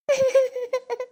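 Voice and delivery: high wailing sob. Acted. Aunt telling of her sister's death, a high wailing sob. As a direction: let a sob break out high.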